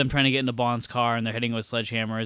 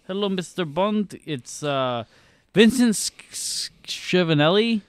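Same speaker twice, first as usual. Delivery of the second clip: put-on Doctor Kemper's accent; 6 semitones higher than usual. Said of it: "[name] really does the accent just like him".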